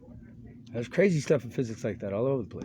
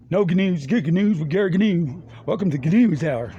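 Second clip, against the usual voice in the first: loud, higher announcer voice; a louder, higher announcer introducing the news hour; TV host intro; 5 semitones higher, 5 dB louder than usual. Retold or imitated. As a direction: open the show like a cheesy host. Quoting TV show intro.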